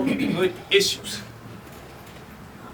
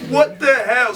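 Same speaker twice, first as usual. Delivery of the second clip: louder, shouting the words; shouted disbelief; 5 semitones higher, 5 dB louder, slower than usual. Real, not acted. Repeated outburst, shouted.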